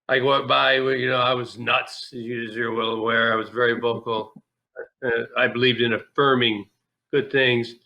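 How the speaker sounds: ordinary delivery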